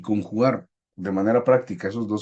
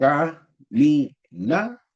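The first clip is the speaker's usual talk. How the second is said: "camina" broken into slow, separate beats; syllable by syllable; 3 semitones higher, much slower than usual. Shown as a demonstration. Teacher counting syllables for class, "camina" in slow separate beats. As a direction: say "camina" one syllable at a time, pausing between. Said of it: "Cada golpe de voz representa una sonida".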